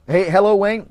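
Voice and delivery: ordinary talk, nothing marked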